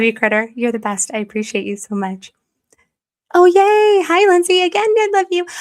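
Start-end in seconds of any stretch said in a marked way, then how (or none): 3.3-5.5 high, loud happy hello; excited greeting. Real, not acted. Streamer greeting a returning viewer, excited. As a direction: squeal a delighted hello.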